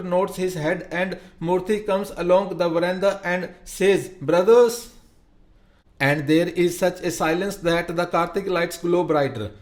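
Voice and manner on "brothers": loud, high call to the crowd; one word to hush a whole crowd. A narrator's reading. Murthy calls the crowd to silence.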